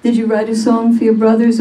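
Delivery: ordinary delivery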